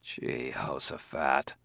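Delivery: very soft, slow, under the breath; a tired inner sigh of an oath; muttered thought. Acted. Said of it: "thought Bailey".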